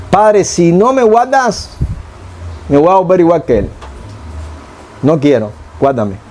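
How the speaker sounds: a simple heartfelt plea to God; sincere pleading that fades to guárdame; humble prayer. Shown as a demonstration. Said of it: "Esa es la oración del hombre humilde".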